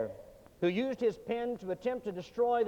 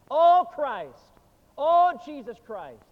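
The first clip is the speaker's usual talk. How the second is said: louder, higher desperate cry; desperate outcry; 6 semitones higher, 6 dB louder, slower than usual. Retold or imitated. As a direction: plead in panic, higher and louder. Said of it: "he cried out more desperately".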